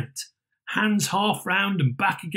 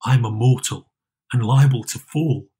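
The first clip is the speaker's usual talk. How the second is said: put-on lower, louder anxious old man; pleading Scrooge; 5 semitones lower, 4 dB louder than usual. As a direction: plead with the spirit.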